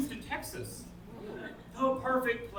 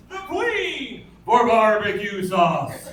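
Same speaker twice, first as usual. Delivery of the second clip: emphatic punchline; 11 dB louder, much slower than usual. Acted. Repeated punchline, punched harder.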